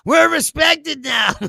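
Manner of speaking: high, loud, slow bragging Trump impression; mock Trump boast. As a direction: puff up and boom it out like a pompous president. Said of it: "Trump keeps walking around".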